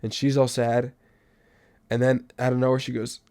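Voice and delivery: ordinary talk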